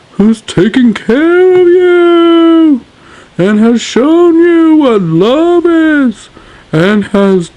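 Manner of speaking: high, slow, grand speech voice; strange king voice. Acted. King addressing his subjects in a strange voice. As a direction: orate like a solemn king. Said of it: "why is he talking like that".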